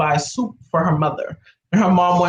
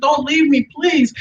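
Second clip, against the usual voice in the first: high-pitched retold plea; re-enacted anxious begging; pleading; 7 semitones higher, slower than usual. Retold repeated plea to cousin.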